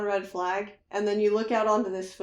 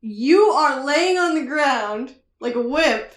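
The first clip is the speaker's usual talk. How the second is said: loud, raised mock rant of a mean coach, voice cracking with laughter; angry coach; 5 semitones higher, 6 dB louder than usual. Friend plays the coach scolding his sick son, laughing loudly. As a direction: berate the kid like a ranting coach through laughter. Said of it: "He's like".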